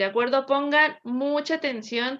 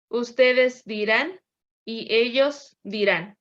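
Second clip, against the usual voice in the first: each form spelled out slowly; teacher modelling future tense forms slowly; slow conjugation drill; slower than usual. Shown as a demonstration. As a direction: dictate the forms one by one, slowly. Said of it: "el verbo decir en futuro".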